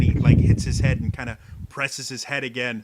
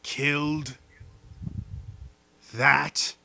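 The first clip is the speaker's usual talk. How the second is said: creature voice; 6 dB louder, much slower than usual. Acted. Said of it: "He's like".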